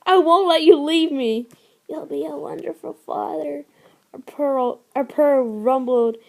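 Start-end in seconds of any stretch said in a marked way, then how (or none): 0.1-1.5 higher, louder pleading insistence; mate crying out in denial; loud desperate denial. Acted. Mate refuses her dying. 1.9-3.6 softer, higher gentle reassurance; a frail loving goodbye; soft tender farewell. Acted. Dying mate comforts him.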